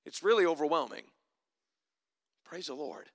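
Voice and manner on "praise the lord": much softer, low murmured praise; hushed praise. Real, not acted. Preacher to congregation after the climax, hushed praise. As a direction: let it drop to a reverent hush. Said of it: "hushed praise after the climax".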